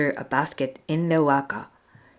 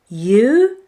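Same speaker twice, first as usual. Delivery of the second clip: louder mocking "You?"; scoffing; 6 semitones higher, 5 dB louder than usual. Brothers scoff at Maui, louder and mocking. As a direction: laugh off the idea. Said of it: "the brothers scoffed".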